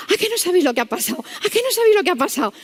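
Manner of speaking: high, rapid, rising excitement; excited gossip. Retold or imitated. Nurse greets morning shift with the story, excited gossip. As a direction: imitate someone bursting with news, building. Nurse bursting with news, retold.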